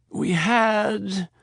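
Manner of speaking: put-on a touch of drunken slur; slurred. Wounded Donatella on morphine, lightly slurred. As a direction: drag the words. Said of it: "Donatella slurred her words".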